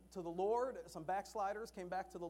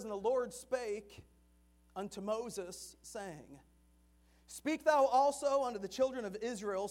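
scripture reading; 4 dB louder than usual. Acted. Preacher reading Exodus to the congregation, solemn and measured. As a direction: read the verse out with weight, like scripture.

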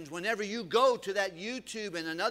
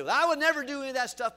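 higher, louder and fast protest; mock objector; 7 semitones higher, 9 dB louder, much faster than usual. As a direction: toss off the denial like a defensive listener. Mocking an objector in the pews.

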